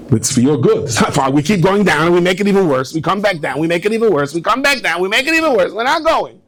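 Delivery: building from firm to high and insistent; escalating protest. Retold or imitated. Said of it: "I said".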